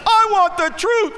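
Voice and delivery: high, shouted courtroom demand; Tom Cruise. Acted. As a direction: cue the crowd with a dramatic movie shout. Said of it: "let's try it again".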